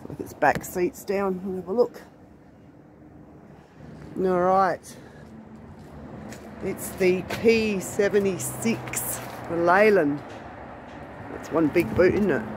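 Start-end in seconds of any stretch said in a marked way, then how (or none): none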